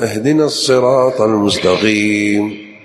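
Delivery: المستقيم with a gh in place of ق; drawn-out al-mustagheem for المستقيم; wrong ghayn in المستقيم; qaf as ghayn. Shown as a demonstration. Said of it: "نطق القاف غين".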